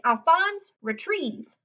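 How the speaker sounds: put-on boy training his pet, calling its name out loud; calling the dog. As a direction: call the dog's name out, then give the command. Lewis commands the dog.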